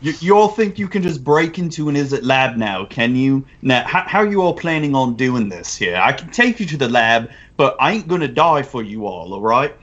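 Cockney brute bodyguard; London accent. Acted. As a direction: challenge them in a London accent. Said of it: "I gotta go more London here".

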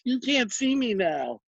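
put-on five-year-old taunt. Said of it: "like when a five-year-old goes".

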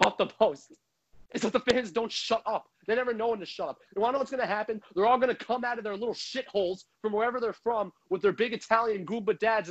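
normal talking voice

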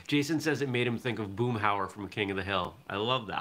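ordinary talk, nothing marked

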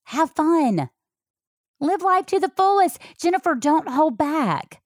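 high, lightly voiced loving mom; mother's advice. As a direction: lift the voice as the mother urging her on. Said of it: "She would say".

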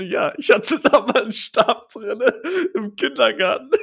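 high, loud, laughing through the words; laughing half-proud admission; laughing while telling. Retold or imitated. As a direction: giggle it out as an absurd confession. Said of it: "Ich sag so".

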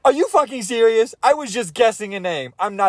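high-pitched, incredulous outburst; astonished. Real, not acted. Guesser learns his random name was right, astonished. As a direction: blurt it out in real disbelief.

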